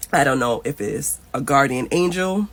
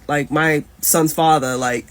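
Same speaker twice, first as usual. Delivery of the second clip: ordinary talk, nothing marked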